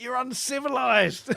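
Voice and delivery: high, loud mock shout of a pompous Englishman. English Bob shouting at the townsfolk as he leaves, mock loud. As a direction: yell it like an offended gent being thrown out. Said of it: "he's just shouting".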